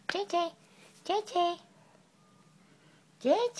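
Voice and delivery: high-pitched pet call to the dog; calling the dog, high and bright. Real, not acted. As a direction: call the dog's name in a high sing-song. Calling the dog's name.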